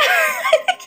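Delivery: high, quiet giggle; real laugh. Real, not acted. Fan amused by her own singing, high soft giggle.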